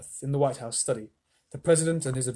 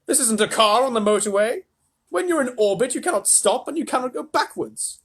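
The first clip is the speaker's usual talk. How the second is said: higher, lively explaining; a touch of a lecturing showman; lightly voiced Mr Wonka; 9 semitones higher, 6 dB louder than usual. A narrator's reading. Mr Wonka explains orbits to grandma, lightly voiced.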